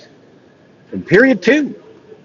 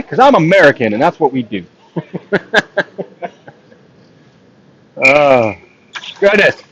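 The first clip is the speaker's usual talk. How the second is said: ordinary talk, nothing marked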